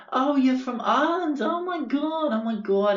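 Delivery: put-on very high, gushing Long Island boyo; hammed-up Long Island excitement at meeting someone Irish; Long Island accent. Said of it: "real Long Island boyos".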